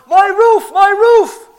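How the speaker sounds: high, full-voiced yell from downstairs; outraged homeowner hollering at top pitch; yelling owner. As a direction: shout it up at the men on the roof. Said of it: "And he's yelling at us".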